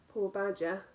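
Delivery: plain reading voice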